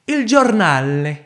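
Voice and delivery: loud, high, drawn-out hammed-up Italian; caricature Italian accent. Narrator announcing the café's name, hammed-up Italian accent. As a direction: put on a mock Italian accent. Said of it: "faire cet accent italophobe".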